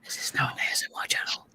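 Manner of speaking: mock ASMR whisper. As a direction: whisper it playfully. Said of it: "Why are you whispering?".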